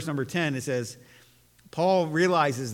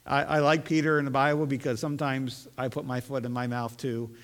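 normal talking voice

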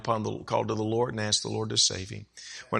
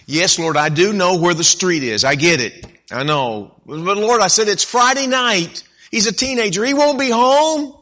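loud pleading with God; 9 semitones higher, 10 dB louder than usual. Retold or imitated. Arguing with God, retold pleading.

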